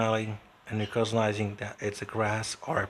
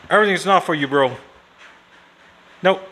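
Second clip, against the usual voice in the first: high, very loud telling-off; loud scolding; 6 semitones higher, 14 dB louder, faster than usual. Real, not acted. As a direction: call out firmly to hold the pet back. Scolding an eager animal.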